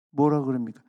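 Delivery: ordinary delivery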